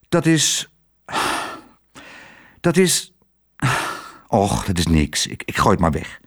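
halting with heavy sighs, dropping low; sigh, break off, then dismiss it dejectedly. A narrator's reading. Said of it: "waarom zucht je zo". (zuchtend en aarzelend, steeds lager)

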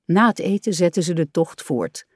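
plain reading voice

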